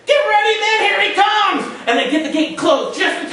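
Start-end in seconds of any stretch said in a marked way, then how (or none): 0.1-1.7 shouting. Acted. Carl shouts a warning down to the men, loud high shout. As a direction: warn the men below at full voice. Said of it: "Carl shouts down to the men below".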